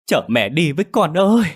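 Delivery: high, loud grief-stricken wail; wailing cry. A narrator's reading. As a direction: cry out over her dead son. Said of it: "mà kêu gào lên". (người mẹ ôm xác con kêu gào thảm thiết)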